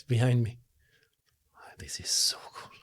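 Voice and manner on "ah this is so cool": awed whisper. Real, not acted. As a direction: breathe it out in wonder. Ghost appears behind him.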